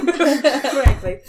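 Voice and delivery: mock sniffy Frankly; lightly voiced snob; imitated sniffy voice. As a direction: repeat it like a snob. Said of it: "She said".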